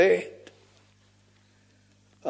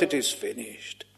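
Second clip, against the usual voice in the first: much softer, lower, barely breathed; hushed dying words; 7 semitones lower, 12 dB quieter than usual. Acted. Preacher voicing Christ's dying words, very hushed. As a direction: let it fade out like a last breath.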